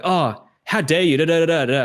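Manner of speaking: mock outrage. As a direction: play the offended party over the top. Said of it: "I'm gonna become really emotional".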